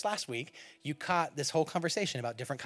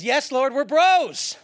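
loud, high, over-eager buddy; chummy mock reply; 9 semitones higher, 11 dB louder, much slower than usual. Preacher paraphrases Peter to Jesus, loudly hammed-up and chummy. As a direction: belt it out like an overkeen pal.

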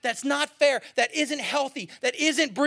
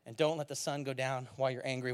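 solemn quote; 7 semitones lower, 6 dB quieter than usual. Retold or imitated. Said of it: "And he goes".

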